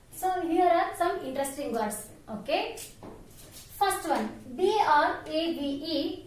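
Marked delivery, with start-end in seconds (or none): 4.6-6.3 slow spelling of B R A V E; spelled out letter by letter. Shown as a demonstration. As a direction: spell B R A V E slowly, one letter at a time. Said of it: "How to pronounce that word?".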